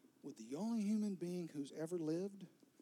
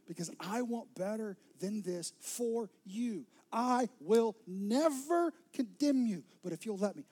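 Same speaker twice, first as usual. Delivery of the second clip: louder and higher, slowing on never condemn; rising plea; 4 semitones higher, 8 dB louder than usual. Acted.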